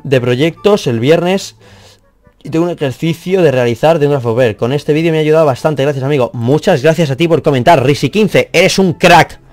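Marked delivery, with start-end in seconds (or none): none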